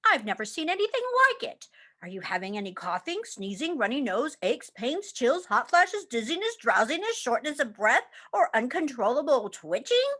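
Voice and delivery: fast doctor listing symptoms; rattled-off checklist. Acted. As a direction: rush through the list.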